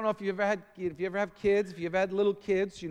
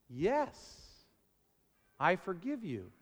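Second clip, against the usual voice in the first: gentle forgiveness; 6 dB quieter, slower than usual. Acted. Voicing God gently.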